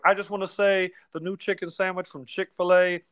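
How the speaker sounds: lightly voiced Chuck plugging a sandwich; imitating Chuck. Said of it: "he's like".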